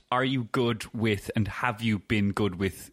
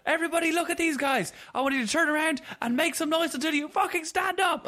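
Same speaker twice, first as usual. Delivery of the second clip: much higher, hammed-up rock star hyping the crowd. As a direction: mimic a cocky frontman working the arena, pitched way up. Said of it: "And he goes".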